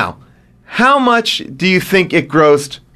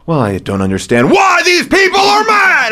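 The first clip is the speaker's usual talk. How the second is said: hammed-up wail of bafflement; Slumdog Millionaire impression; 11 semitones higher than usual. Host mocks the actor's lines from the movie, overwrought impression. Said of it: "did a lot of lines like this".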